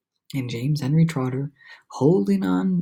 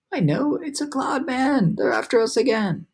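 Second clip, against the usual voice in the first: frightened; 8 semitones higher than usual. A narrator's reading. Miss Spider fearing cloud men, high frightened. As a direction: wail the warning.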